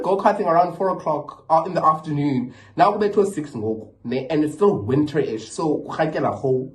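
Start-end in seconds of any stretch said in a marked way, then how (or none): none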